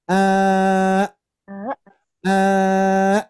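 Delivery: long "Eh" stopped by a glottal catch; held hamzah demo. Shown as a demonstration. Teacher showing parents how a stilled hamzah sounds, long then stopped. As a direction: stretch "Eh", then shut it off hard in the throat. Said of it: "nah, gitu ya".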